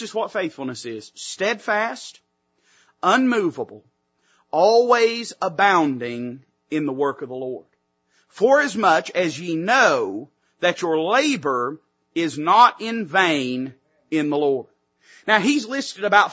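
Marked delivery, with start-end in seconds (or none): 1.1-3.8 each word set apart by long pauses; slow reading. Shown as a demonstration. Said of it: "read this verse again slowly". 4.5-7.7 drawn-out, deliberate scripture reading; slow reading. Shown as a demonstration. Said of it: "read this verse again slowly". 8.3-14.7 slow, drawn-out verse with long pauses; slow reading. Shown as a demonstration. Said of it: "read this verse again slowly".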